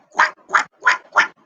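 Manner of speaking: short imitated duck quacks. Shown as a demonstration. Storyteller asks children about the duck, quacking. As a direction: quack four times like a duck. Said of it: "Or the duck who does".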